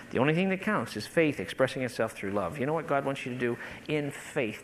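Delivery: ordinary delivery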